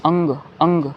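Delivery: "अंग" with a ringing ng; "अंग" repeated, closing on ng; anusvara as ng. Shown as a demonstration. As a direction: sound out "अंग" as ang. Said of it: "यहाँ पे अंग की आवाज़".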